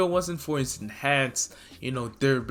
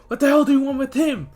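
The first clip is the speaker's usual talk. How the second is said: higher, louder, fast; angry demand; 12 semitones higher, 6 dB louder, faster than usual. Acted. Izuku angrily confronting the villain over Bakugo. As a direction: snap it out protectively.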